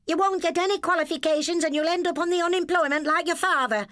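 high, loud scolding yell; yelling stepmother. Acted. Stepmother yells at truant boy, high furious shout. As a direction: tear into him, furious. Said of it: "she yelled".

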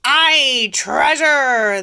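loud, high pirate cheer; pirate crew shout. Acted. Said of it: "the others shouted".

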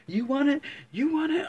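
very high, loud taunts; scared taunting yell. Retold or imitated. Frightened man yelling dares at the dogs, high and loud. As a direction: yell the dare up high out of fear. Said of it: "I'm fucking scared as fuck".

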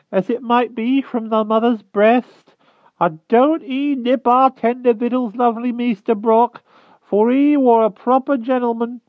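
put-on a frightened old hedgehog begging humbly; timid hedgehog voice. A narrator's reading. Hedgehog begging the Wart for mercy, high timid rustic voice.

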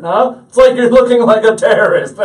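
high, shouted teasing jab; loud mock accusation. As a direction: holler it like an obnoxious buddy. Buddy's loud accusation, acted out.

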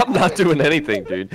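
ordinary talk, nothing marked

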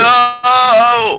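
loud, high-pitched "no" shout; shouted refusal. Real, not acted. Player refusing offered dirt, shouted refusal. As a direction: yell it out long and high.

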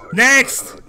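high loud call of next; shouted call. Acted. Border guard yelling for the next traveller, loud. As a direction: call it out across the booth.

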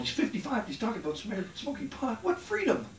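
judgy audience. Retold or imitated. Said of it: "some of you are sitting there going".

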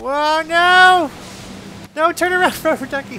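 high, loud panicked yells; panicked shouting. Real, not acted. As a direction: scream it as you crash. Panicking during crash.

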